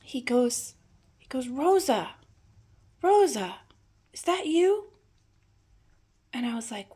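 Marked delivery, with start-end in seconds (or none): 1.5-4.9 imitating grandpa calling out, higher and louder; raised questioning call, retold; calling her name. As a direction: call out as if to someone behind a door. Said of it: "And he called my name".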